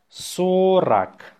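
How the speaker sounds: slow, stretched-out dictation. Shown as a demonstration. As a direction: draw the word out for learners. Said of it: "say it slowly".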